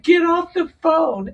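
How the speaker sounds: high, slow mock shout; mom shouting. Mom in the car ordering her daughter off the call, shouting. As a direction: bark it like an angry mother. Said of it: "really mad".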